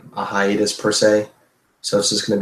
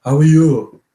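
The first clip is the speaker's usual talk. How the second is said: loud, higher Austrian action-star voice; hammed-up Austrian strongman greeting; Arnold; 4 semitones higher, 7 dB louder than usual. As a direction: boom it out in a mock Austrian accent. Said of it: "I'm doing my Arnold voice".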